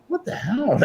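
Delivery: as if finding a lost watch, baffled; stunned surprise. Retold or imitated. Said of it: "And I'm like".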